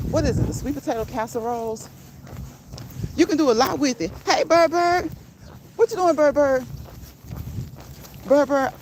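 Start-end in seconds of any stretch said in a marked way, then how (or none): 4.2-8.7 pet talk. Real, not acted. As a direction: coo the name up high to the bird. Talking to her pet bird.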